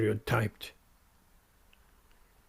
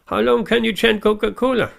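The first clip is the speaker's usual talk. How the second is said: challenging retort rising higher and louder; Prabhupada's retort; 5 semitones higher, 4 dB louder than usual. Retold or imitated. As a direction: throw the challenge back at the heckler. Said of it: "And then Srila Prabhupada said".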